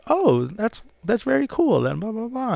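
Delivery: high-pitched British interviewer impression; politely pleased British voice, pitched well up; English interviewer. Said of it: "A British accent".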